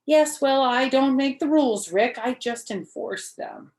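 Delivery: loud and high, matter-of-fact; bossy. Acted. Girl telling boyfriend the rules, bossy. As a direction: lay down the law.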